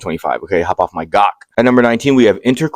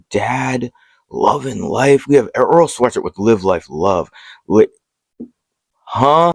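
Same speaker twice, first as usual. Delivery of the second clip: ordinary talk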